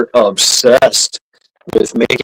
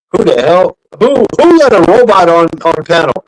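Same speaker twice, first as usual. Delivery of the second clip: shocked outburst; 6 semitones higher, 4 dB louder than usual. Real, not acted. Surprise at a robot voice.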